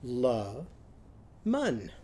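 "La mun" in two separated syllables, both with a short uh; changed vowels. Shown as a demonstration. Teacher modelling lemon with Upton's sound, "La mun" split with uh vowels. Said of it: "what's the lemon going to be now?".

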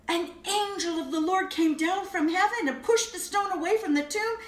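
ordinary talk, nothing marked

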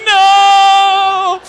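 high, loud wailing no; long loud drawn-out no; anguished no. Retold or imitated. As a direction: cry it out in despair. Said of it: "he's like".

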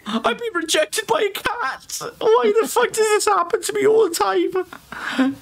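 squeaky dramatic self-pity; high mock despair. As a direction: wail it in a high voice. Mock outrage at cat rejection.

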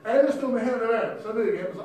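loud preacher relishing the tale; animated preaching. Real, not acted. Preacher tells the crowd about the shofar, animated preaching.

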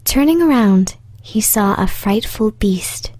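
usual speaking voice

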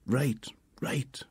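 whispered right, right; boy whispering after his mum's telling-off; whispered under breath. A narrator's reading. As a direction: whisper it sulkily to himself.

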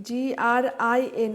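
slow letter-by-letter spelling; teacher spelling sullen for students. Shown as a demonstration. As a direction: dictate each letter clearly, one at a time. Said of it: "green".

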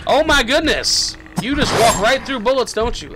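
high, loud disbelief; exasperated yelling. Real, not acted. Player shooting an unstoppable enemy, exasperated yelling. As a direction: complain loudly in mid-fight.

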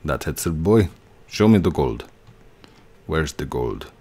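put-on low, accented villain voice. Player tries an accent in game, sounding like a low villain. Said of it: "That sounds like a villain".

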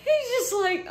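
high squealing laughter; laugh-crying. Real, not acted. Telling viewers, high laugh-crying squeal. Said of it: "laughing fit".

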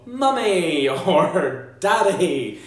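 put-on high, eager child guesses; a little child blurting out a word; child guessing. Child guessing wrong word while blending, eager mock child. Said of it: "and they'll go".

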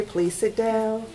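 ordinary delivery, nothing marked

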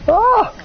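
sudden high, loud yelp of joy; a real burst of delight; excited shriek. Real, not acted. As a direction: squeal of pure glee. Overjoyed at reaching 71 subscribers.

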